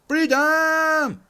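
high, loud cry of freedom; excited shout. Real, not acted. Player cheering as they escape the cave, high excited shout. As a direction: yell it like you just broke out.

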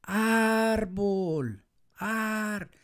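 high, drawn-out "árbol" held on the ar; calling out a word. Shown as a demonstration. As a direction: call árbol, stretching its first syllable. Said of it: "voy a gritar la palabra".

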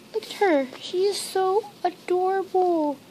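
high-pitched gushing over something cute; real adoring excitement; excited cooing. Real, not acted. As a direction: coo in delight, voice lifted high. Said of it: "so excited".

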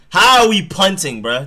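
can't believe the call; real disbelief; outraged disbelief. Real, not acted.

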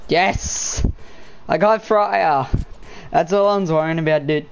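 thrilled shout of joy, slowed on the win; real gamer hype, loud and high; excited. Real, not acted. Gets the skin he wanted.

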